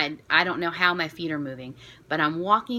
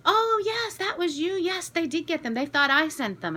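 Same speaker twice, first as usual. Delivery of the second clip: much higher, gushing imitation of the staff woman; delighted recognition; 11 semitones higher than usual. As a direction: squeal it like she's thrilled to meet you. Staff woman recognizes cake-pop sender.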